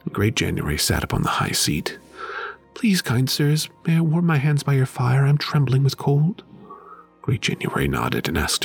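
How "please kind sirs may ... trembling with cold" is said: put-on a timid girl's plea, pitched higher; pleading girl. A narrator's reading. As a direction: beg the twelve months for warmth by the fire. Marusha begs the months.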